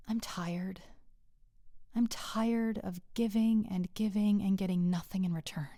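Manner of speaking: soft whispered confession. Retold or imitated. Husband in bed to his wife, weary whisper. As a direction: breathe it out, worn down.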